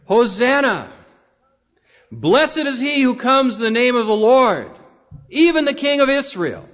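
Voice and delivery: high, proclaiming crowd cry. Acted. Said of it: "began to cry out".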